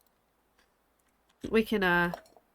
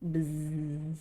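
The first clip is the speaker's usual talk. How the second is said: low, soft bzzz; imitated buzz; 5 semitones lower, 9 dB quieter than usual. Shown as a demonstration. Showing the sign for B, soft low buzz. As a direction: buzz quietly like a bee.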